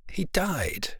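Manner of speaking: near-whispered, grave announcement; hushed grief. A narrator's reading. Sailor tells the owner of the captain's death, hushed. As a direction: break the bad news under your breath.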